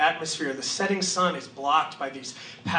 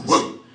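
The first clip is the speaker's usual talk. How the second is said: whoop sound; 7 dB louder than usual. Retold or imitated. Sound of self dissolving.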